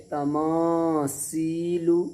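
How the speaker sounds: drawn-out तमासीलु with a whistling s; wrong seen sound. Shown as a demonstration. Teacher showing how sa turns into seen, clear s. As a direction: say तमासीलु slowly with s, not th. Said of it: "सीन बन जाएगी".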